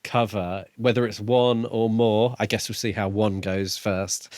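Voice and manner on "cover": ordinary delivery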